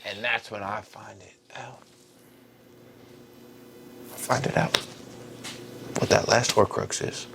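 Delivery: hushed, breathy build to the reveal; dramatic whisper. Acted. Harry builds suspense for the listener, whispering. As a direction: whisper it like a big secret.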